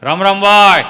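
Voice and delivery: loud greeting. Acted. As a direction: call out to the shop. Old man greeting shopkeeper.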